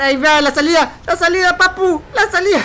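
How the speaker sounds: high, loud frantic calling; urgent panicked calling for the exit. Real, not acted. Streamer fleeing a chaser, frantic.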